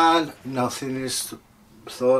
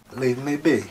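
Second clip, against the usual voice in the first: usual speaking voice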